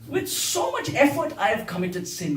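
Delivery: mock-proud protest, starting high; sarcastic complaint. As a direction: lament your hard work in mock grievance. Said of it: "This whole song is sarcastic".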